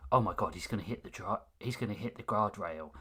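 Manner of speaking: soft, fast, low Oh my God; quiet internal-monologue voice; inner monologue murmur. Shown as a demonstration. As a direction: mutter it under your breath as a thought. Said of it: "that sort of like".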